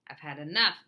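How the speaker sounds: a fed-up teacher's complaint; peeved teacher. A narrator's reading.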